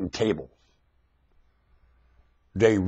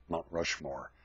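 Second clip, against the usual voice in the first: ordinary talk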